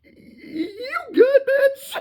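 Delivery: shrill squealing mock yell; high mock shout. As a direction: screech it in disbelief. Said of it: "And Sundancer go like".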